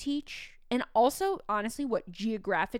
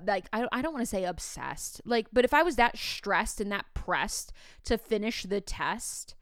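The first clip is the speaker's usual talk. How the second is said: usual speaking voice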